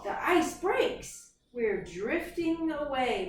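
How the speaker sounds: slow, high, urgent storybook reading; dramatic reading. Acted. Teacher reading the ice breaking scene, slow dramatic read. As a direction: stretch each word for drama.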